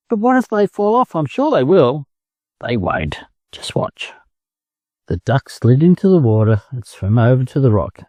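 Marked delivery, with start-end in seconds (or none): 0.1-2.1 high, anxious hen voice; fretting in a high pitch; worried hen. Acted. Hen fearing chicks will fall. 2.6-4.1 soft whisper, calm and sure; whispered reassurance. Acted. Duck calmly reassures hen.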